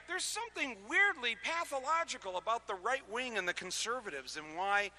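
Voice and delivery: ordinary talk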